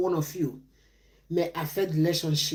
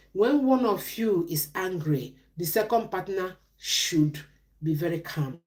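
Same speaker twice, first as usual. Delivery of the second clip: ordinary talk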